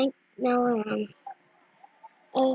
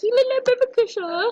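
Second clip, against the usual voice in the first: high, quick cooing at a pet; baby-talk teasing; 5 semitones higher, faster than usual. Retold or imitated. Owner teasing her cat, baby-talk. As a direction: coo fast in a raised voice.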